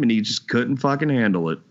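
usual speaking voice

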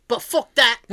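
imitated London cabbie, dismissive; thick London accent. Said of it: "like, that thick accent".

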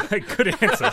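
loud laughing reply. Real, not acted. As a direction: laugh through the words. Laugh heard.

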